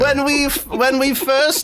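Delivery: high, put-on English accent; badly faked English. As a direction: attempt a fussy English accent. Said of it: "cunty English accent throughout this entire bit".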